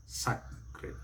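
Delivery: sacred split slowly as "SACK-rid"; short A, drawn-out sacred; mispronunciation. Shown as a demonstration. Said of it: "never sacred".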